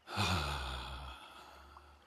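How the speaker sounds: long audible sigh out; voiced sigh. Shown as a demonstration. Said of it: "letting my voice come out a little bit in the".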